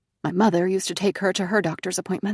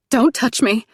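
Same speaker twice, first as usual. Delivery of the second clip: sharp, louder warning; recoiling; 5 semitones higher, 7 dB louder than usual. A narrator's reading. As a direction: snap back at her, louder. Nina recoils from mother.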